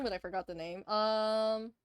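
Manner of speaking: ordinary talk, nothing marked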